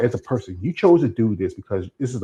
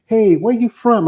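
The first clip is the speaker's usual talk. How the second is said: high, drawn-out imitation of a girl; girl's voice; 11 semitones higher, 4 dB louder, slower than usual. Girl asking him at the club, imitated high and drawn out. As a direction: lightly imitate a girl calling out, pitched up. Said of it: "this girl said".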